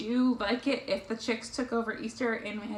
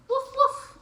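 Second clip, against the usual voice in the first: high, loud little dog bark; puppy woof; 6 dB louder than usual. Acted. Reader voicing Biscuit the puppy for children, high woofs. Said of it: "woof like Biscuit".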